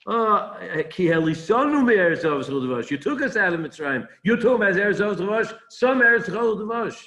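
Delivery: mock the promise with scorn; derisive mockery. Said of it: "They deri-, derisive".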